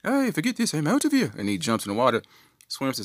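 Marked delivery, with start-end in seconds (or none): 0.0-1.3 high, loud mock-Scottish outburst; badly faked Scottish accent, pitched up; Scrooge McDuck. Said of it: "that's not a Scottish accent".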